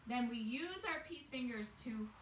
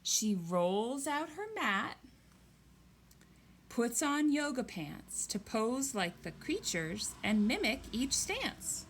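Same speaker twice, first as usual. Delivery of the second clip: reading a picture book aloud, louder and slow; story reading; 8 dB louder than usual. Acted. Teacher reading a kids' yoga book to the class, storytelling voice. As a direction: read it like a bedtime storybook for kids.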